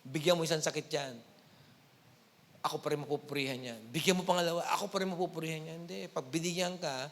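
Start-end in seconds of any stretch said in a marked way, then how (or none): 0.0-5.8 much lower, fast scheming devil; a lightly voiced devil plotting. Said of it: "sabi ng Diablo".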